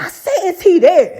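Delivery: repeating it with a laugh; high, laughing insistence. Retold or imitated. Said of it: "she was like".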